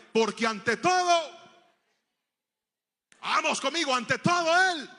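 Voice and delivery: proud declaration. Acted. Preacher voicing his childhood pride, ringing out loud. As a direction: proclaim pride above all. Proud boy's motto, repeated.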